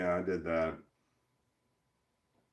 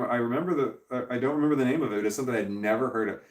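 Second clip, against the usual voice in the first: usual speaking voice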